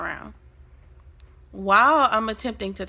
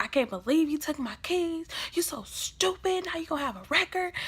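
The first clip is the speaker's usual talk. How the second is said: high-pitched, softer scolding; mock angry sister; 7 semitones higher, 6 dB quieter than usual. Sister whose keys were taken, mock angry scolding. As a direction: imitate a fed-up sister telling you off. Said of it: "So she's pissed off".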